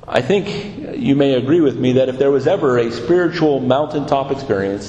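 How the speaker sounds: normal talking voice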